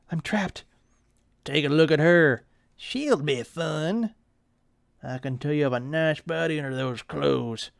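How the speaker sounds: leer at her, take your time; sleazy thug. Acted. Creeps cornering a girl.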